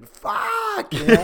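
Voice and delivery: put-on high, thin baby cursing; newborn baby voice. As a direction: squeak it like a newborn.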